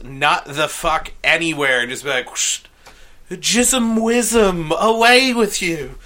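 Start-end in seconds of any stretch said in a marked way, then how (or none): 2.3-6.0 mock wizard incantation. Wizard casting a silly spell.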